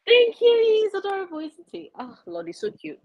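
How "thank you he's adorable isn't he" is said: much higher, squeaky pet voice. Real, not acted. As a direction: gush at the cat, quick and high. Cooing at the cat.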